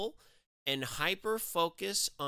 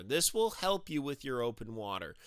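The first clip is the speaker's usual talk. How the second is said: usual speaking voice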